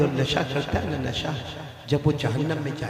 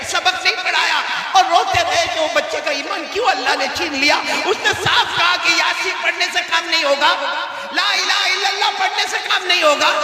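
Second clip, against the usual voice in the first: high, forceful grieving narration; impassioned preaching; 12 semitones higher, 4 dB louder than usual. Real, not acted. Preacher telling of the teacher's grief, impassioned. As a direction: preach the story out, pitched high.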